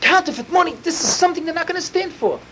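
high, indignant declaration; the judge won't stand for this; outraged judge. Retold or imitated. Judge declaring the counterfeit money intolerable, high and indignant.

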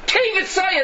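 high-pitched emphatic outburst; emphatic exclamation. Acted. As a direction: throw the shocking phrase out high. Hurling out the shocking quoted phrase.

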